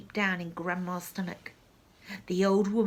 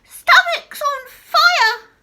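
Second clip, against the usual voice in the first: high, loud stammering yell; loud, stuttering panic; panicked shout; 14 dB louder, much slower than usual. A narrator's reading. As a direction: scream for the fire brigade. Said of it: "she shouted suddenly".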